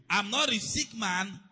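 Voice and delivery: loud, drawn-out proclamation; forceful declaration. Real, not acted. Preacher repeats the healing confession to the church, declaring it forcefully. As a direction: declare it slowly and loudly.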